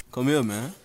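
ordinary talk

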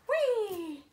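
high, loud gleeful "Whee!"; gleeful squeal. Real, not acted. Thrilled by the gift, squeals with glee. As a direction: let out a happy squeal. Delight at her channel colours.

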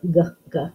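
g, g as hard "guh, guh"; isolated letter sound. Shown as a demonstration. Teacher sounding out grape, g as short "guh". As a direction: sound out hard g twice, separately. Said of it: "What do you hear?".

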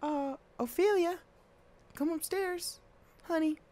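high, soft mother's voice; mom voice. Acted. Mom calling Ophelia to bed in a high soft voice. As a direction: call the child up sweetly like a mom.

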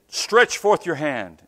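loud, slow command; commanding call. Retold or imitated. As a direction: order the hand to stretch, slow and loud. Commanding a healing in prayer.

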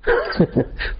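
sudden squeaky high laugh; short high hoot of laughter; woodcutter laughs. Acted. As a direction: yelp of a laugh at the gatekeeper. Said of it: "द्वारपाल को देखकर कठियारा हंसा".

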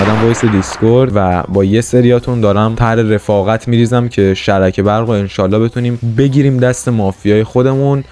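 usual speaking voice

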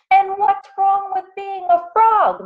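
very high, put-on offended voice; indignant exclaiming. A narrator's reading. Frogs answer the parrot's slight, indignant high exclaiming. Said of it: "exclaimed the frogs".

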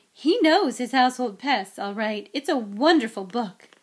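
loud, gushing endorsement; beaming admiration. Acted. Mother admiring the wizard's book, gushing. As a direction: sing his praises with beaming delight. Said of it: "Missus Weasley beamed down at him".

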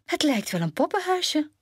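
put-on high-pitched girlish wonder; delighted exclamation. A narrator's reading. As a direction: marvel at it in a high voice. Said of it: "riep ze uit".

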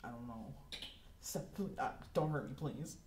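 fast, soft, stammering plea; frightened Benson begging not to be hurt; panicked stammer. Acted. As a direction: babble it out in terror. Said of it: "Benson just immediately is gonna be".

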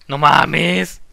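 loud dismayed No mames. Real, not acted. Repeated reaction at dark room.

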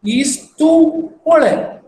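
teacher stressing "tum"; emphasised example. Shown as a demonstration. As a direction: lean on "tum" in "tum polai". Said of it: "I'm just saying".

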